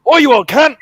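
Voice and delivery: loud, high and aggressive; angry Aussie shout. Shown as a demonstration. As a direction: bark it like you want a fight. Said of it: "you can probably tell that I'm angry".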